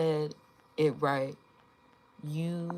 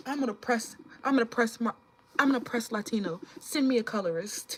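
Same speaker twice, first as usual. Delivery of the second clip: mock social media pitch; 7 semitones higher than usual. Mocking how commenters should market themselves online, hammed-up pitch. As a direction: sell it like a cheesy self-promo ad. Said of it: "That's how y'all should start marketing".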